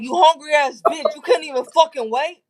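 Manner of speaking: high, angry yelling; angry shout. Retold or imitated. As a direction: yell at her, outraged. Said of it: "He was like".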